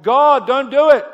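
sudden high, loud cry of anguish. Pretend. Father crying out to God to stop his son's death, anguished loud cry. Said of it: "no voice to cry out".

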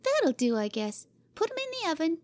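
put-on high, satisfied child's order; bossy little girl. Cora sending Jimmy to the oven: bossy high girl voice. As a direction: done and pleased.

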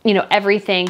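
ordinary delivery

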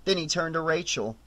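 ordinary delivery, nothing marked

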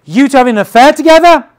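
loud, high, fast outburst of rage; a king who thinks he caught an affair, roaring; furious king. Acted. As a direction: blast it like a jealous king. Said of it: "He could be like".

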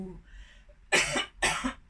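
sudden breathy coughing fit; real cough. Real, not acted. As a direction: cough hard, then stop. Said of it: "อืม ขอโทษค่ะ". (ไอแรงๆ จริงๆ)

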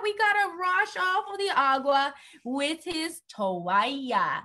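ordinary talk, nothing marked

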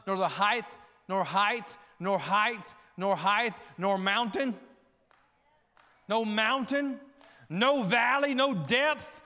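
loud, emphatic list, growing in force; building scripture declamation. Acted. Preacher declaring Paul's conviction to congregation, building declamation.

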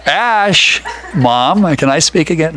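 loud, playful call to his mom. Real, not acted. Jokingly calls to his mother.

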